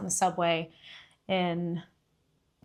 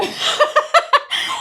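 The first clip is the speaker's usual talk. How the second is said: high, loud laughing fit; real burst of laughter; 11 dB louder than usual. Real, not acted. Speaker laughing at being asked to photograph others, real laughter. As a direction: crack up at your own story, loud and high. Laughs at her own retold story.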